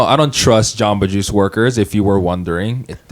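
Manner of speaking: usual speaking voice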